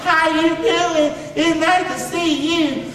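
high, slow, sugary put-on voice; mock nice coach. Man mocking the gentle coach he never had, sugary and high. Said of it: "I didn't have".

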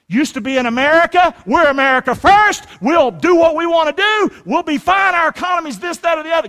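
high, loud mock boasting; cocky attitude. Preacher mimics America-first swagger to congregation, cocky attitude. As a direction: crow it as a brash, self-sure boast. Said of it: "You can have that attitude".

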